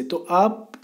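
ordinary talk, nothing marked

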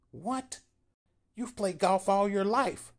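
very high, shocked squeak of disbelief; can't believe what he hears; Paul stunned that Bill hates golf, high and loud; astonished Paul. A narrator's reading.

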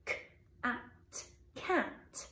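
k and at split, then blended cat; segmenting cat. Shown as a demonstration. Teacher splits the word for children. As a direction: sound out cat piece by piece. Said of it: "I can see at the beginning".

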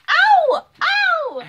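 sharp high cry of pain; loud yelp. Real, not acted. Elbow hurts while tickled.

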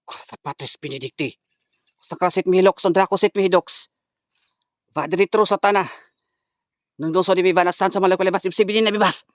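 chanted Latin exorcism prayer; incantation. Acted. Vlogger reciting exorcism prayer at possessed woman, chanting. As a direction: intone it like a priest casting out demons.

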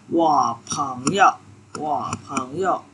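slow, careful Mandarin 我朋友; slow model Chinese. Shown as a demonstration. Teacher modeling for students, slow clear Mandarin. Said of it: "teacher models Chinese phrase".

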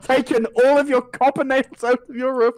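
high, lightly voiced smug tradesman; smug roofer. Roofer explaining to a family, lightly voiced smug. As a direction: play the cheeky roofer taking payment. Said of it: "some family just like".

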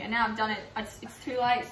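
ordinary talk, nothing marked

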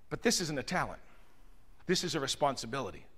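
low, soft and serious; hushed sincerity; quietly earnest. Real, not acted. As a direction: drop your voice to make the point land. Drops voice for earnest point.

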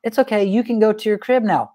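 breezy and unbothered, no anger; cheerful dad calmly handing out the consequence; mock sweet calm. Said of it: "you just come over here like".

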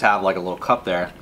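eating between words; talking while chewing. Real, not acted. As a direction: mouth partly full of food. Seen 1: Talker in orange shirt holds burger throughout, bites it near the end.

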